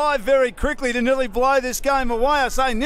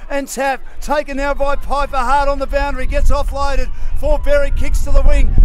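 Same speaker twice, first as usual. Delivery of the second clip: louder and louder as the play races; building excitement; 3 semitones higher, 11 dB louder than usual. Acted. Caller urgent as Narre Warren attacks late, building excitement. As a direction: ramp up the call toward a shout. Frantic late-game passage.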